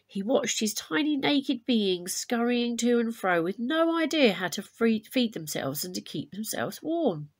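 lively storybook reading to a class; story narration. A narrator's reading. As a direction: read it like a myth for children, clear and bright. Teacher reads myth aloud to class.

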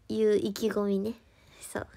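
soft unvoiced whisper; whispered aside. Real, not acted. Whispering to fans after her bold vow, soft aside. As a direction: breathe the aside out, no voice.

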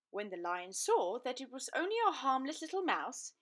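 usual speaking voice

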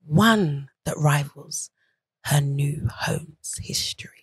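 slow, hushed, creepy whisper; low whispered horror read, drawn out slowly. Pretend. Reading a film synopsis in their best horror voice, creepy whisper. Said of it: "in your best horror voice".

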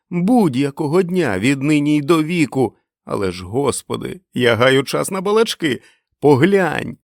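loud, elated outpouring; jubilant calling. Acted. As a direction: call to your wife in wild excitement. Freed Einar calls out to wife.

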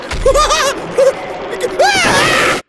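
high shrieks mixed with laughing; screaming laughter. Real, not acted. Gamer cornered by the monster, high screaming laugh.

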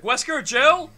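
high, loud questioning call; imitated call-out. Retelling a game's opening, imitated calling out. Game character calling out, retold.

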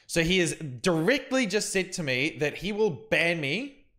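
usual speaking voice

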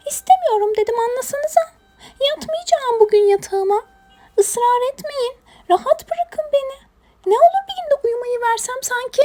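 high-pitched protesting little creature; stubborn hedgehog. Acted. Hedgehog begs to skip bedtime, high stubborn whine. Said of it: "inat etti uyumamakta".

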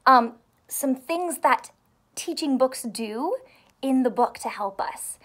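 ordinary delivery

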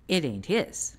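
normal talking voice

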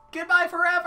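high, loud theatrical cry; dramatic farewell. Acted. Game character banishing the hero for good, melodramatic shout. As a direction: belt out a melodramatic goodbye.

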